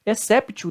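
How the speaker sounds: exceptio with a hard T, "esséptio"; mispronounced exceptio, T kept instead of an S sound; wrong T pronunciation. Shown as a demonstration. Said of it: "também está errado".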